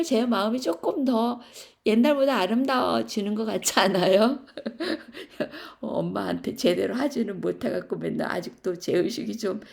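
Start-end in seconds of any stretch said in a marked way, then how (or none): 0.0-4.4 asks through a laugh, louder; laughing question. Real, not acted. Laughing at herself. 4.5-5.7 a short, high, breathy burst of laughter. Real, not acted.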